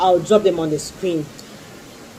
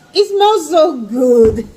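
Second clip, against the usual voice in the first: higher, slow, drawn-out swoon; swooning delight; 6 semitones higher, 4 dB louder, slower than usual. Real, not acted. Vlogger swooning to viewers over the scrub's smell, higher and slow. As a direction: melt over the scent, stretching each word.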